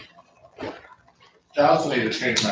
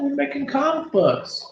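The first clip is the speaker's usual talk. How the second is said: high silly voice; 13 semitones higher than usual. Pretend.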